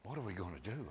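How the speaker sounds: quiet wondering repeat; hushed musing. Acted. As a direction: ponder it under the breath. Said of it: "and he asks Philip".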